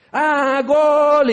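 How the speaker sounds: high, loud imitated goal yell; goal shout. Preacher imitates neighbors cheering a goal, loud mock yell. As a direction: belt out the goal like a fan. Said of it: "sí gritan".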